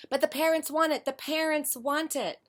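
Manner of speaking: hammed-up pushy parent voice; mock parent. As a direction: parrot the demanding parent with a sneer. Mocking a pushy parent's demand.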